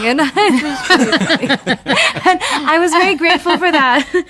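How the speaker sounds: real laugh, then words spilling out through it; can't stop laughing as you say it. Real, not acted. Laughing while telling fan story.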